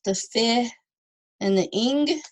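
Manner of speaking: "fi" and "ing" each set apart; vowel sounds isolated. Shown as a demonstration. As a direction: point out "fi", pause, then "ing". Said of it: "two vowels".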